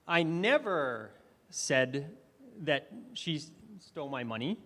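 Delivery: "I never said" drawn out slowly with weight on never; stress on never. Shown as a demonstration. Speaker demoing stress to the audience, stress on never. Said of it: "I emphasized different words".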